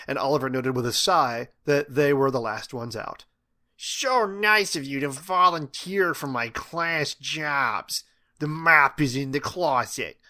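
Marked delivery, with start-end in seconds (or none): 3.8-10.2 slow, drawn-out schoolteacher voice; Missus King. A narrator's reading. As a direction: hand out chores with slow mock sweetness. Teacher orders boys to clean.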